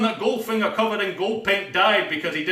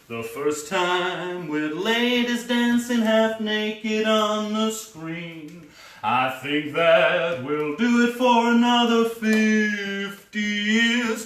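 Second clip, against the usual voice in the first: slow, higher, stately announcement; grand announcer; 5 semitones higher, much slower than usual. Acted. Narrator presenting the title dancers, stately. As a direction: proclaim it like a trailer.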